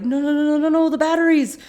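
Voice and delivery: high, loud string of protests; alarmed. Retold or imitated. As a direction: cry out in mock alarm. Saeed alarmed about batteries dying.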